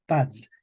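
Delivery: "Pads" ending in a dz cluster; medieval Spanish pronunciation. Shown as a demonstration. Teacher demonstrates medieval Spanish, "Pads" with final dz. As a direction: close "Pads" on d plus z. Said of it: "Esta es la pronunciación del español medieval".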